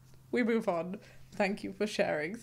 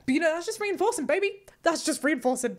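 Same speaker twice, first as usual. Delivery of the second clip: high, loud, fast cheerleading; excited and playful; 7 semitones higher, 6 dB louder than usual. Acted. Hyping up the viewer, playful high loud cheer. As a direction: hype it up like a cheer.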